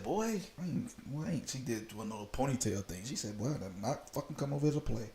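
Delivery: soft woman's voice. Retold or imitated.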